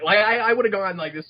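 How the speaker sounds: ordinary talk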